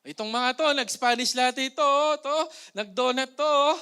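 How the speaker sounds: loud teasing call-outs from the gym crowd; mocking gym crowd. Gym-goers mocking the members over latte and donuts, loud jeering. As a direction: jeer at them like gossiping gym-goers.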